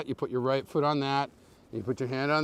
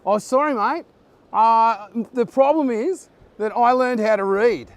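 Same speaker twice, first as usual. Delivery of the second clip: loud, higher-pitched mock Australian; Aussie accent attempt; 6 semitones higher, 7 dB louder than usual. Replying to a viewer who praised the attempt, loud put-on Aussie accent. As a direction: lay on a hammed-up Aussie accent. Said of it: "beautiful Aussie accent attempt".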